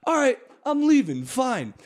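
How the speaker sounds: put-on huffy drunk kid. Kid sulking as he gets kicked out, high and huffy. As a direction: storm off in a sulk. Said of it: "and he's like".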